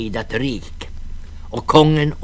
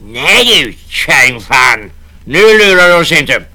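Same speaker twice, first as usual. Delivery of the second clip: loud defiant snarl; angry navvy; 9 semitones higher, 11 dB louder than usual. Acted. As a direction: refuse her flatly. Navvy refuses her.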